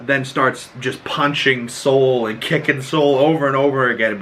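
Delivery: usual speaking voice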